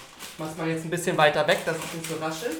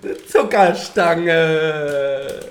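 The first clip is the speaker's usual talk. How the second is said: drawn out; 6 dB louder, much slower than usual. Real, not acted. Repeating slowly.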